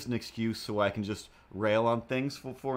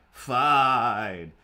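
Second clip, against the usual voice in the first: "Fine" shouted louder, drawn out and falling; loud exasperated fine; 8 dB louder than usual. Retold or imitated. Giving in to the comic's nagging, loud drawn-out "Fine". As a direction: give in with a loud groaning "Fine".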